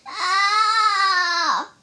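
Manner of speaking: long, high, loud "aah" wail; sustained high howl of pain; pained cry. A narrator's reading. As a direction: yell out from a punched belly.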